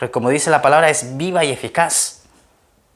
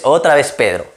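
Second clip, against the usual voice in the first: ordinary delivery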